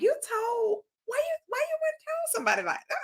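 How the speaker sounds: high, fast, mock-shocked boy; mocking high accusing voice. The boy blames her for telling, high and quick mock. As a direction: squeal it like the caught-out boy.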